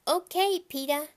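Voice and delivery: put-on high, loud toddler chirp; little kid voice. A narrator's reading. Fudge answering his big brother, high chirpy toddler. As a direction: sing it out like a cheery three-year-old.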